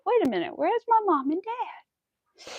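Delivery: high, slowing puzzled little dog voice; confused dog voice. Pretend. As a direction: let the fun drain into worry. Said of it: "he would be like".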